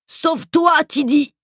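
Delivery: high desperate shout. Acted. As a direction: yell a frantic warning. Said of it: "avait crié".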